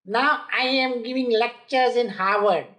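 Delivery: mock a speaker milking his own rejections; self-promoting speaker. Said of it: "a person who talks like this".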